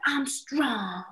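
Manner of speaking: little son's affirmation. Retold or imitated. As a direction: announce it like a small boy. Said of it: "he'll be like".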